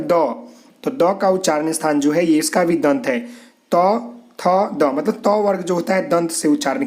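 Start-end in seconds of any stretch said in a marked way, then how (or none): 3.2-3.8 त said unvoiced, tongue touching the teeth; whispered त demo. Shown as a demonstration. Teacher whispering त to show the students. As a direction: breathe out a bare त for the class. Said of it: "त बोलेंगे तो आपका जीभ दांत में ठेकेगा".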